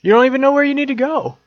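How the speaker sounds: fast, high, loud teasing; teasing jab. Real, not acted. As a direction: fire it back mockingly. Teasing retort.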